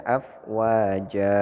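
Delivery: "afwaja" drawn out with an open final A; slow model reading of "afwaja"; correct reading. Shown as a demonstration. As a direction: hold the last A of "afwaja" without adding N. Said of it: "Tapi bacanya".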